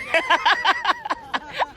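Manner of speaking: high burst of laughter; loud laugh. Real, not acted. Big laugh at spelling.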